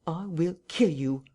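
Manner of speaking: slow, deliberate death threat; slow menacing threat. Acted. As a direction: lay each word down coldly. Voice threatens to kill.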